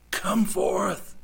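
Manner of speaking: loud, high, commanding call; archangel's command. Acted. Preacher voicing the archangel calling the dead out, commanding shout. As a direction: call it out like a summons from heaven. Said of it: "with the voice of the archangel".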